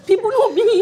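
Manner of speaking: high-pitched, laughing protest; squeaky, amused delivery over laughter. Real, not acted. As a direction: say it through real giggles. Joking after laughing at own remark.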